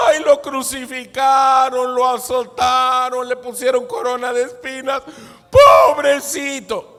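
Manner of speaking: loud, high wailing pity; mock lament. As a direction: wail with exaggerated pity, stretching the last word. Mocks the pitying earthly version.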